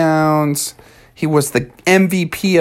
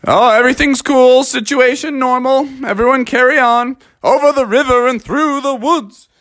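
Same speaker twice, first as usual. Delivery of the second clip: higher voice, falsely casual dad; raised, upbeat cover-up; reassuring Father Bear; 8 semitones higher than usual. Acted. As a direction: insist all is fine and restart the song. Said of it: "Father Bear was like".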